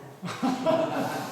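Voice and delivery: a loud open laugh at his own joke; hearty laugh. Real, not acted. Lecturer laughing at his land-buying joke, loud laugh. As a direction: burst out laughing, high and loud.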